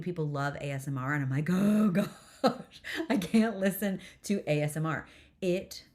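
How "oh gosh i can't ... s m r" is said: loud, high groan of revulsion; exasperated outburst. Acted. Podcaster telling listeners she can't stand ASMR, exasperated outburst. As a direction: recoil loudly, pitch jumping up. Acting out her horror at ASMR.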